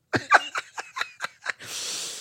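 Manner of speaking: quiet, wheezy burst of laughter; real helpless laugh; laughing fit. Real, not acted. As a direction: crack up at your own joke. Cracks up at own joke.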